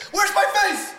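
high panicked mock cry; panicked victim voice. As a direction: squeal it like a mauled victim. Mauled bear victim, mocked.

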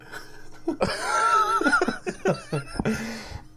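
long, high burst of laughter; helpless laughter. Real, not acted. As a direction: crack up completely at the joke. Cracks up at the joke.

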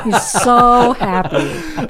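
high, drawn-out gush; gushing delight. Real, not acted. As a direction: coo slowly over how adorable it is. Gushing over pizza-eating dog.

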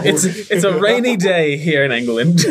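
high, laughing mock British accent. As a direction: announce it like a cheeky Brit. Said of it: "stuck in English accents".

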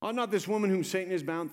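preached line with not pushed; emphatic scripture quote. Shown as a demonstration. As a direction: lean on not in Ought not this woman. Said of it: "Think of it".

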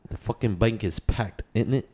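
put-on a light London street accent, rough and cheeky; mock street British accent. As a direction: ham up a London geezer. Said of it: "I feel like I'm fucking from the streets of England".

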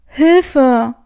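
loud, distant call for help; cry for help. Acted. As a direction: yell from far off. (lauter Hilferuf aus der Ferne)

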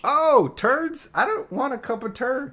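very high squeaky mock confusion; squeaky mock mishearing. Son pretending to mishear grandma, very high. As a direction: squeak it like a baffled child.

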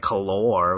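"color" stressed on its end, French-style; mock French pronunciation. Shown as a demonstration. Host mocks the U spelling, Frenchified "color". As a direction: say "color" as co-LOR, faux French. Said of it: "it gets all French to me".

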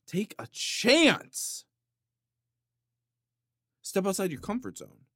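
rally the crowd to be bold; hammed-up motivational shout; mock rallying cry. Rallying cry about hot sauce.

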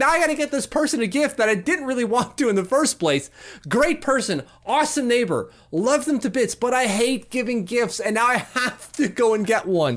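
high-pitched exasperated inner rant; freaking out. Retold or imitated. Stuck buying the neighbor an unwanted gift, exasperated and high. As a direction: rant in a high, put-upon voice, replaying the panic. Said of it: "I'm freaking out in my head going like".

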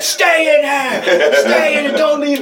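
frantic high yell; shouting. Retold or imitated. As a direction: yell it like a crazed guru. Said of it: "and he was like".

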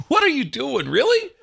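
high, loud incredulous mock reaction; baffled disbelief. Graduates looking back at the name guessers, mock-incredulous. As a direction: imitate someone aghast at a botched name.

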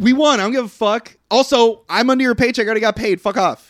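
high-pitched, brash brush-off; mock defiant retort. Driver answering Ford about slowing down, mock defiant. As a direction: throw it back at the boss, indignant.

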